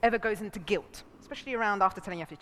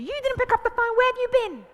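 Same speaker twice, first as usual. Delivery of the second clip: high, loud panicky demand; fearful accusation; 13 semitones higher, 7 dB louder than usual. Shown as a demonstration. Partner confronting someone who didn't pick up, fearful accusation. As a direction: fire it out as an accusing interrogation. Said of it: "second one is coming from fear".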